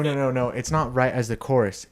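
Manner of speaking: ordinary delivery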